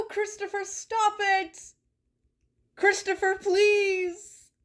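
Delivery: high, hammed-up begging cries; mock desperate plea. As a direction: melodramatically beg him to stop. Mock begging chat to stop.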